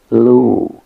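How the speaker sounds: "lu" loud, with the vowel of dude. Shown as a demonstration. Teacher sounding out elusive's middle syllable, loud "lu" as in dude. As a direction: project "lu" as one separate syllable. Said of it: "with the u like in dude".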